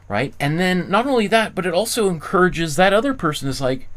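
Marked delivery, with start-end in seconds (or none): none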